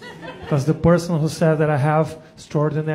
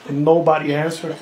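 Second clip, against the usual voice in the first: normal talking voice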